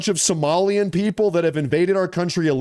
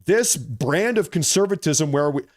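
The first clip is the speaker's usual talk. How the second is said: ordinary talk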